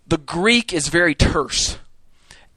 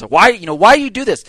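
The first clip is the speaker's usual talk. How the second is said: exasperated challenge; 6 semitones higher, 8 dB louder than usual. Retold or imitated. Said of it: "grabbing hold of Peter's coattails".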